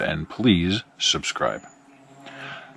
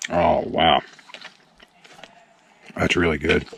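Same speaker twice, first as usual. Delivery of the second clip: talking while chewing; mouth full; 5 dB quieter than usual. Real, not acted. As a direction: speak through a mouthful of chicken. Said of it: "talking with his mouth full".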